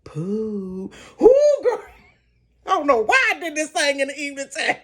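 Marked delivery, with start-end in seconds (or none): 0.0-0.9 low drawn-out eerie call; ghostly call. Retold or imitated. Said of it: "they started saying". 1.2-1.8 high loud squeal with a laugh; excited squeal. Real, not acted. Reacting to scare.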